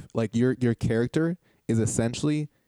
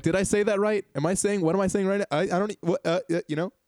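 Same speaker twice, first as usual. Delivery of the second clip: higher-pitched, stumbling anxious questions; self-doubting; 7 semitones higher than usual. Acted. Host showing player a character unsure of her words, self-doubting. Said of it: "constantly doubting whether you're communicating".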